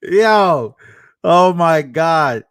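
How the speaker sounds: loud burst of disbelief, slow on oh my God; excited outburst. Real, not acted. Streamer reacting loudly to a wild clip, stunned and excited. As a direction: blurt it out, floored by what you just heard.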